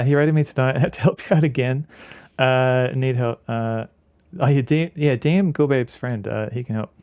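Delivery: normal talking voice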